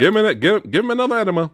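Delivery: doctor's order. Retold or imitated. Doctor ordering enema, retold.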